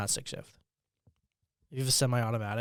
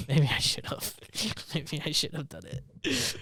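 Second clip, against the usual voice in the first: slow, laughing through the words; 4 semitones higher, 4 dB louder, much slower than usual. Real, not acted. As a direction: laugh it out slowly. Laughing about his childhood prank.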